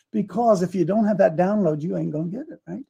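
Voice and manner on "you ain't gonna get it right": ordinary talk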